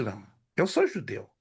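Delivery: ordinary talk, nothing marked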